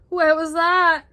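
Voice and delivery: loud, high acted alarm; frightened Rabbit. A narrator's reading. Lost Rabbit hears noises in mist, alarmed. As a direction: cry out in jumpy fright. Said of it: "his frightened mind playing tricks".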